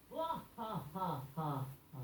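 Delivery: soft, spaced-out vampire cackle; separate, quiet stage-villain ha-has; Dracula laugh. Acted. Assistant ends requested Dracula impression, vampire laugh. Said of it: "do your Dracula impression".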